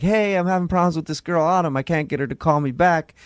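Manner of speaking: usual speaking voice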